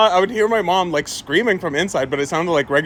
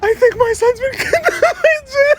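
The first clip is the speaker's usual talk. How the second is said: wailing. Retold or imitated. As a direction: wail it out to grandma. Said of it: "my mom wailing".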